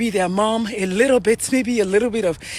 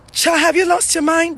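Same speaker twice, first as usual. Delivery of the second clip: mock scandalized parent, pitched high; scolding Nigerian parent; 7 semitones higher than usual. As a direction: play the shocked parent scolding a child. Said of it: "That's what they're gonna say".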